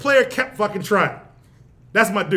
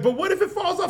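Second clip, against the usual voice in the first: lightly voiced wife, pitched up; wife's objection; 7 semitones higher than usual. Wife questioning his sticker plan, lightly imitated in higher pitch. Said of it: "she was like".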